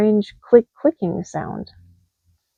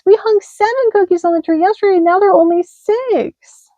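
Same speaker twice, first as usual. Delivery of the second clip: high indignant mouse voice; tiny mouse complaining; baby mouse protests, squeaky; 10 semitones higher, 3 dB louder than usual. Acted.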